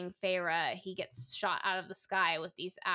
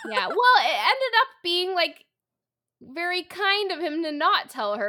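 much higher and louder, talking through laughter; laughing; 9 semitones higher, 10 dB louder than usual. Real, not acted. Reader laughing while defending the hero to her friend, high and loud. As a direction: let the giggle spill into the words.

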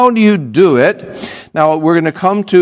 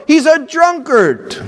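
high, aggrieved complaint; complaining wife; 12 semitones higher than usual. Retold or imitated. Preacher voices another wife's excuse, high whine.

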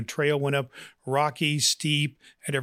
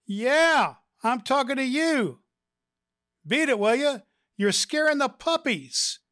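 squawky parrot; 11 semitones higher than usual. Acted. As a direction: play a rude talking parrot. Parrot taunts woman again.

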